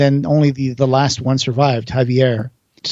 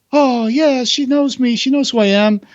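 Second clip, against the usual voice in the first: delighted Goya; 10 semitones higher than usual. Retold or imitated. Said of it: "And he goes like".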